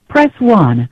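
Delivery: ordinary delivery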